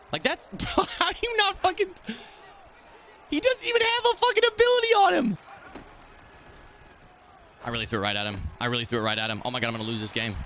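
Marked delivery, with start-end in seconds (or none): 0.1-5.4 much higher, squeaky disbelieving cry with a laugh; can't believe the drop, voice cracking up high; incredulous outburst. Real, not acted. Gamer cries out in disbelief at a dropped pass, pitch shooting up.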